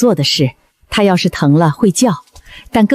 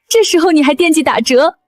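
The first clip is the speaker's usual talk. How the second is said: high, tearful mock reproach; 8 semitones higher than usual. Owner crying over a sick cat's joke about discounts, tearful. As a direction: scold fondly through tears. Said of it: "别哭".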